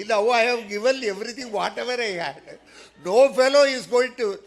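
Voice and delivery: high and loud, half-laughing wail; loud wailing lament. Retold or imitated. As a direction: cry out that you are ruined. Disciple wails, gave all away.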